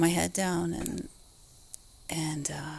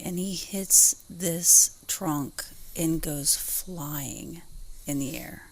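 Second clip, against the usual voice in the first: usual speaking voice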